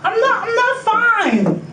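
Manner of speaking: high, slow, pleading; distressed plea. Real, not acted. Detainee tells officer she's unwell, high slow plea. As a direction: stretch it out high and upset.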